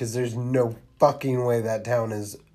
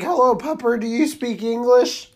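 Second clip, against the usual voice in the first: put-on high, sing-song baby talk to a dog; talking to a child; 10 semitones higher, 3 dB louder than usual. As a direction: coo at the dog like a toddler. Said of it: "talks to the dog like she's talking to a fucking child".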